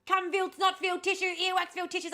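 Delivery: loud, high exasperated outburst; angry rant. Real, not acted. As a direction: let the frustration explode. Ranting about tissues left around.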